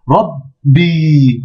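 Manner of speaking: "Rabbi" blocked on the b, then a long i; doubled consonant. Shown as a demonstration. Teacher demonstrating the shedda, "Rabbi" with doubled b, long i. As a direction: stop on "Rab", then release a held "bi". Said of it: "Mais il y a une shedda".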